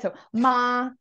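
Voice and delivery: "ma" said slowly in a Mandarin tone, each one clear; careful teacher's tone demo of "ma". Shown as a demonstration. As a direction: model the tone on "ma" for a learner. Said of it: "I'll say them just so you can kinda hear".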